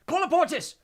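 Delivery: loud high breathless spell; gasped spell. A narrator's reading. Hermione sealing the door in panic, gasped. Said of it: "gasped Hermione".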